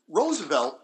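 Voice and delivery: normal talking voice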